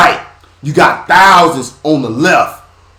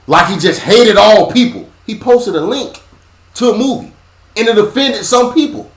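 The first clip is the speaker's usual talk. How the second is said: preaching; 7 semitones higher than usual. Acted. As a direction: preach it with building fire. Impassioned preaching about Kyrie.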